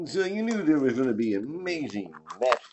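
smooth radio announcer read. Acted. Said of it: "I do like to use my radio voice".

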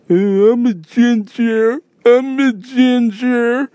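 put-on high, slow, whiny baby voice; dumbass baby voice. Mocking the Zoom guy as a high slow whiny baby. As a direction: whine it out like a dumb big baby. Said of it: "that's exactly how I picture him sounding. Like, freaking dumbass baby".

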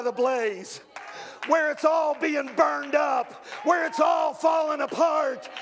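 rising, shouted preacher cadence; full-force pulpit preaching. Acted. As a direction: preach it hard to the congregation.

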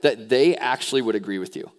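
ordinary delivery

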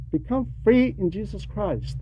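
ordinary talk, nothing marked

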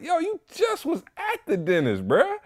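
very high, disbelieving squeak; stunned he was there again; incredulous. Retold or imitated. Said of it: "I'm like".

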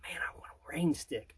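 breathy dreamy whisper. Retold or imitated. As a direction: whisper it like a wish.

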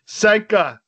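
Jamaican movie impression. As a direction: quote the film in a mock Jamaican accent. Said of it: "Shout out Cool Runnings".

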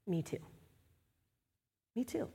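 to someone knee to knee, soft; quiet admission. Retold or imitated. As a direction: say it simply, soft and close. Quoting shared confession.

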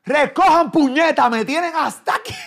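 high, loud shrill scolding yell; hammed-up grandma yell; mock grandma yell. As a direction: scream it like a fed-up grandma. Imitating grandmother yelling at kids.

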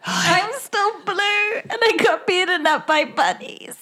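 in-character voice. Acted. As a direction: play it small and pitiful. Player speaks in character.

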